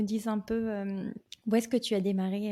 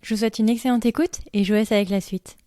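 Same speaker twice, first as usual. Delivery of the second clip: louder, presentational host read; podcast intro; 6 dB louder than usual. Acted. Host welcomes listeners to the expat families podcast, presentational intro read.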